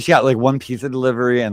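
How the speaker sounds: usual speaking voice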